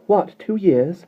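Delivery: high, loud indignant outburst; be shocked, then furious on the bird's behalf; outraged anger. A narrator's reading. Mary Poppins outraged at the lark's two years caged. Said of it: "her face flushing with anger".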